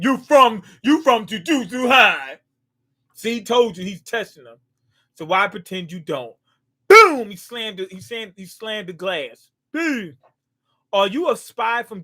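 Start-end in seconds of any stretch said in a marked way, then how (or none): 0.0-2.4 shouted accusation, louder; mock-accusing outburst. Accusing spy during anime reaction. 6.9-7.3 shouted boom sound effect, higher; imitated crash sound; loud mock boom. Sound effect for slammed glass.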